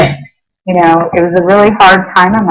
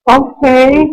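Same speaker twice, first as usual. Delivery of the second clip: high, teary okay through sobs; a wobbly, tearful okay; 7 semitones higher than usual. Retold or imitated. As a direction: let the okay crack with tears. Sobbing after hearing the message.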